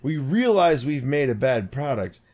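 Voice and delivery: mock corporate apology. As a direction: parody a hollow corporate apology. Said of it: "you can't come out and say".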